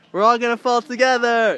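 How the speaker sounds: a loud, happy shout; excited shout. Real, not acted. Shouting to the other beginners, excited and cheering. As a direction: cheer it to the whole group.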